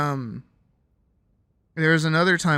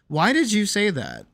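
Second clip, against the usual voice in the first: higher-pitched, challenging retold question; confronting; 6 semitones higher than usual. Retelling challenge to partner in the car, confronting. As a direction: demand an explanation, retold.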